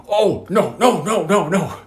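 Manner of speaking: high, loud rapid-fire objection; hammed-up teacher crying out in protest; exasperated teacher imitation. Acts out teacher cutting pupil off.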